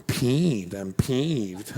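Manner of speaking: lightly mocked wimpy voice; mildly mocking, low-energy delivery. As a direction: play a feeble man barely bothered. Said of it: "That seemed, like, weird and wimpy".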